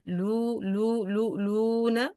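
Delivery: lu with the waw pushed through the nose; wrong way: close the lips and send lu into the nose; nasal wrong waw. Shown as a demonstration. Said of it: "this sound is go to خيشوم". (خطأ: lu من الخيشوم)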